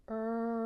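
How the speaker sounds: "Er" with rounded lips, voiced and pushed; teaching the R sound; R sound demo. Shown as a demonstration. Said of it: "Can you do it just like me?".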